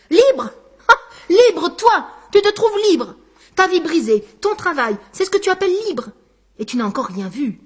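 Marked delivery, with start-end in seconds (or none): 0.1-6.1 loud, high scornful jeering; mocking laughter. A narrator's reading. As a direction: laugh the word libre in his face. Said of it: "Textor hurla de rire". (Textor se moque à gorge déployée, aigu et fort)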